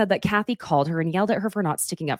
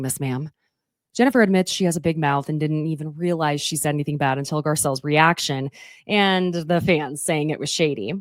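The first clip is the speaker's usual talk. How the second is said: ordinary talk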